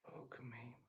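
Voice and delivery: whispered fuck me in exasperation; whispered curse. Real, not acted. Streamer fed up with live chat haters, whispered curse. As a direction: breathe it out, fed up. Exasperated after reading comments.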